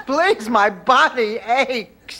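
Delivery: high, drawn-out plea; pleading complaint. Acted. Weary woman begging Blanche to stop exercising, pleading complaint. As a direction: plead slowly through the aches. Worn-out plea to be left alone.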